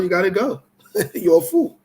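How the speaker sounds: normal talking voice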